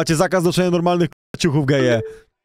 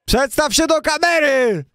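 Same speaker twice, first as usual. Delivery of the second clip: high, slow, shouted command; shouted order; 9 semitones higher, slower than usual. Acted. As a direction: bark the order at him.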